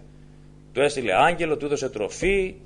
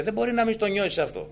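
normal talking voice

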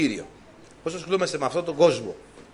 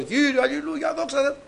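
a high, ringing hallelujah call; retold shout of praise; 9 semitones higher than usual. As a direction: cry out the praise, pitched high. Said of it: "αρχίζω να φωνάζω".

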